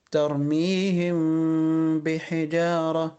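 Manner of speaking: slow, "ترميهم" meem hidden and nasal two beats; ikhfa with ghunna. Shown as a demonstration. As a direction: hum the meem of "ترميهم" into "بحجارة". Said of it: "ما هياش ميم صريحة".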